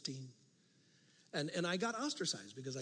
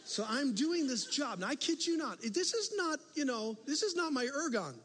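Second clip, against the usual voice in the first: much higher and louder, slow emphatic; preaching climax; 10 semitones higher, 5 dB louder than usual. Real, not acted. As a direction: hammer home the point to the crowd.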